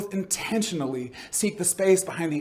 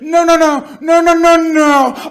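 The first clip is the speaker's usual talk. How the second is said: high, very loud shouted noes; frantic protest; 11 semitones higher, 12 dB louder, much slower than usual. Acted. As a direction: shout over the answer to stop it. Interlocutor protests at peak.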